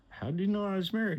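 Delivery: high and fast; quick surprised question. Retold or imitated. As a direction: blurt it out, puzzled. Narrator puzzled by the remark.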